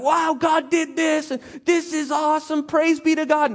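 high, slow, wide-eyed praise voice; a thief hamming up his praise; gleeful Achan. Retold or imitated. Achan gushing praise to the people, high and gleeful.

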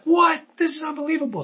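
high, indignant shocked cry; outraged disbelief. Retold or imitated. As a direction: burst out in jealous disbelief. Angry good son voiced.

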